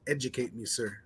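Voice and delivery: ordinary talk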